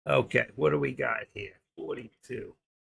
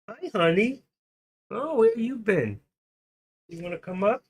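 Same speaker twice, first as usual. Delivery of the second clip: sweet pet talk; 8 semitones higher than usual. Real, not acted. Greeting his pet.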